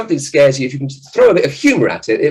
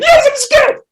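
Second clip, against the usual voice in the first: put-on very high, loud frightened kid voice; scared child; 6 dB louder, slower than usual. Boy tells his dad he's scared in a very high voice. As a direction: whimper it up high like a small boy.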